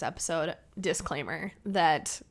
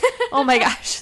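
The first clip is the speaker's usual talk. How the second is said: usual speaking voice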